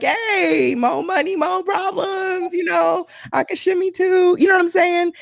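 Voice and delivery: high, shouted party hype; very high shouting party voice; hyped dancing fan. Retold or imitated. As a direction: belt it out like you're dancing at the club. Acts herself dancing to [name].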